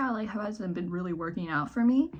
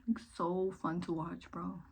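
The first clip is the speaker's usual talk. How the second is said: sarcastic; 8 dB quieter than usual. Acted. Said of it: "Sorry, I'm being sarcastic".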